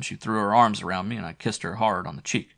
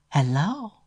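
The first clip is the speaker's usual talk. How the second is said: much higher, sultry answering voice; sexy woman's hello; 9 semitones higher, 4 dB louder than usual. A narrator's reading. Flo answering Matt's call at home, sultry higher voice. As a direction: purr hello down the phone line. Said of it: "Flo's sexy voice said".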